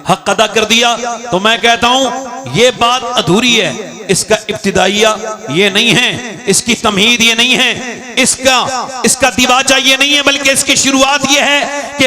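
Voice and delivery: fiery preacher shouting to crowd; booming political rally speech. Acted. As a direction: build to a roaring climax. Sermon climax, high and loud.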